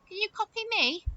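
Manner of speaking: ordinary delivery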